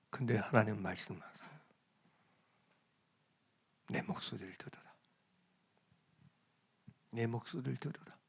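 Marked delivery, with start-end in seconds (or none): 3.9-8.1 soft whispered command; hushed divine voice. Acted. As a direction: whisper it like a still small voice. God's voice contrasted, hushed. (하나님의 음성을 조용히 속삭이듯)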